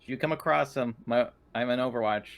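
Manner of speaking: ordinary talk, nothing marked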